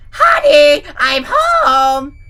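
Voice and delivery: yelled greeting. Acted. Lucy bursting into the room calling to her husband. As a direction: belt it out bursting in. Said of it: "burst into the room and yelled".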